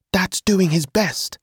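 put-on high, slow, reproachful young voice; angry brother. A narrator's reading. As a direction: stand up for dad in a high, cross voice. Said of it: "Adam turned to me angrily".

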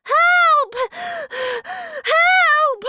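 very high, loud screams for help; repeated piercing cries. A narrator's reading. Boy hopes someone outside hears, loud screaming. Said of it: "I screamed".

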